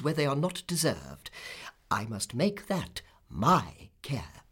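emphatic, decisive pronouncement; insistent speech, slowing at the close; imperious Miss Betsy. Acted. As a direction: lay down the law as a formidable aunt.

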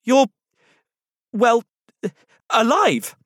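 high, halting, loud disbelief; struggling for words in high-pitched amazement; startled amazement. A narrator's reading. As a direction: squeak it out haltingly, astonished. Startled boy addresses living teddy.

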